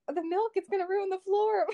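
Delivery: high, fretful mom voice; a hint of a worried mother; imitating panicked mom. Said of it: "She was like".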